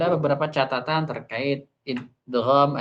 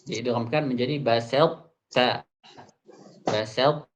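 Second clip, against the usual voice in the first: usual speaking voice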